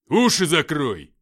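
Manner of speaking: very loud, drawn-out shout; shouted warning. A narrator's reading. Fighter warns comrades of the grenade, full shout. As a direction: yell a warning before the blast. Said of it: "крикнул Артём, падая на камни".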